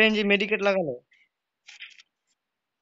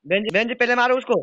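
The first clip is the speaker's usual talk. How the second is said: loud urgent call to a teammate; urgent shout; 5 semitones higher, 5 dB louder, faster than usual. Real, not acted. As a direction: yell it over the game noise. Teammate urged to kill enemy. (Teammate को ज़ोर से पुकारना)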